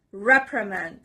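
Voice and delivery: "reprimand" stressed on REP; stress on first syllable. Shown as a demonstration. Teacher showing learners the stress, first syllable stressed. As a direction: hit REP in "reprimand", falling away after. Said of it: "The stress is on the first part".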